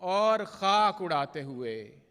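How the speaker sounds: measured, projected mushaira verse with drawn-out line endings; poetry recitation. Acted. Poet reciting love couplets to a mushaira audience, declaimed Urdu verse. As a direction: recite the ghazal line by line, pausing between phrases.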